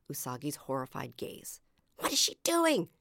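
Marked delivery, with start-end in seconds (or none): 2.0-2.9 high, tense hiss. A narrator's reading. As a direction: hiss it in alarm. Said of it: "she hissed".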